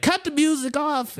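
high-pitched call to cut it; stage command. Retold or imitated. Comic to the club as he takes the stage, calling out. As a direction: re-enact calling out over music.

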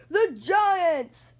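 loud group cheer; team shout. Acted. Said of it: "shouted the team".

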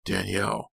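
calling from behind. A narrator's reading. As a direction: call to her from a distance. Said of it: "a voice rang out from behind her".

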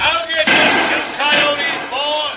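high, slow shouted threat; shouting to the boss mid-fight. Acted. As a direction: holler it over the gunfire. Hank shouting during gunfight.

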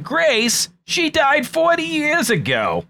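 put-on high, slow quoted old-movie line; movie quote voice. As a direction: pitch it way up and drag it out. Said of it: "To quote Christmas Vacation".

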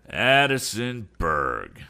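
loud, slow, drawn-out exclamation; exclaiming. A narrator's reading. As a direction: exclaim the name in startled recognition. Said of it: "claimed the aged inventor".

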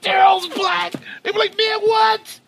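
high crying shout of shock; shocked shriek. Retold or imitated. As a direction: shriek it in disbelief. Crowd shocked discovery repeated.

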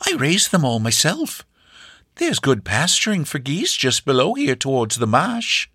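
put-on high, hospitable old farm woman; high-pitched gracious granny; gracious old hostess. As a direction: welcome the guest generously. Hostess chats, orders Sylvia.

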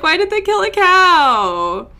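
high, loud horrified question; aghast at the cow; shocked outcry. Retold or imitated. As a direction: blurt it out in disbelief. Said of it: "I'm like".

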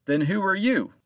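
challenging. A narrator's reading. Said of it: "Captain Joe challenged the old man".

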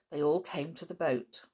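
ordinary delivery, nothing marked

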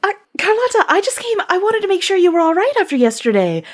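high, hesitant nervous voice. Acted. Understudy apologising to the furious diva, high and nervous. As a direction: stammer it out, anxious to please.

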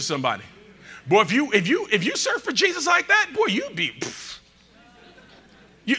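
ordinary delivery

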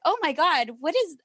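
high, startled "oh my God"; mock shocked coworkers. Colleagues hearing odd noises on a call, mock shock. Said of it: "everyone's like".

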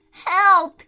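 loud panicked cry; calling for help. Acted. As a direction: shout it down from upstairs. Boy calls for help from above.

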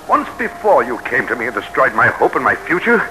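ordinary talk, nothing marked

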